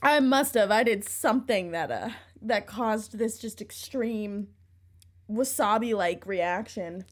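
mouth burning from spicy food. Real, not acted. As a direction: talk through a horseradish burn. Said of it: "my eyes are watering".

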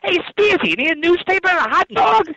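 high-pitched New York accent; squeaky New York street-vendor voice. Shown as a demonstration. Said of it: "a New York accent".

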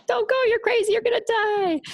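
high, squealed mock warning; devil on the shoulder. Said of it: "a devil on one shoulder".

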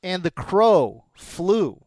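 slow, drawn-out announcer line; slow dramatic. Acted. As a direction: savor each word slowly.

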